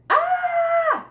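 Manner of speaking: high, loud shrieked Ah; panicked yell. Acted. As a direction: shriek as a mouse jumps in. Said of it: "I yelled".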